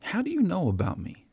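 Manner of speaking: high, amazed question; wide-eyed hopeful surprise; hopeful wonder. Retold or imitated. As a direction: ask it in disbelief, lifting high. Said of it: "read the hope in his words".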